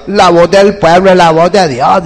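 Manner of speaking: scornful imitation of a popular slogan; mocking quote. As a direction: parody the saying in a silly altered voice. Mocking a saying he calls nonsense.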